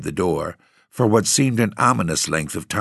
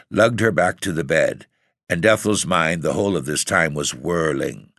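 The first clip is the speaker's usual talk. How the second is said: ordinary delivery, nothing marked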